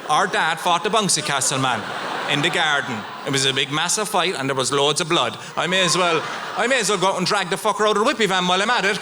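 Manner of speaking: ordinary talk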